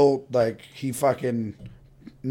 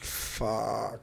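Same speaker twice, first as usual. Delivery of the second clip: muttered curse; 4 semitones lower, 13 dB quieter than usual. Retold or imitated. Caught by cops.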